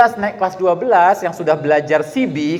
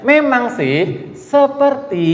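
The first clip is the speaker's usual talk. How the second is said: 1 sarcastic drawl; 7 semitones higher, slower than usual. Shown as a demonstration. Teacher mocking pseudo-piety.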